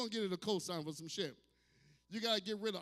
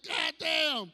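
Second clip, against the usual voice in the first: loud, high-pitched exclamation of disbelief; shocked outburst; 8 semitones higher, 9 dB louder than usual. Retold or imitated. Comic retelling his reaction to the food board, shocked yell. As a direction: belt it out, appalled.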